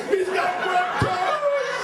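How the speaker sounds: high, drawn-out mock shriek; horrified scream. Wife horrified about newborn's toes, screaming. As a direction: shriek it in exaggerated horror.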